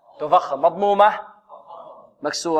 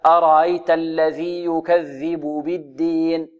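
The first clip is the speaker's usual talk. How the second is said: أرأيت الذي يكذب بالدين slowly, every vowel given its full length; teacher shows the right reading; slow model recitation; slower than usual. Shown as a demonstration. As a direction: recite the verse slowly and carefully. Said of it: "هكذا".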